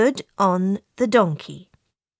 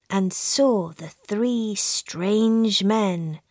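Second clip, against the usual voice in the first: plain reading voice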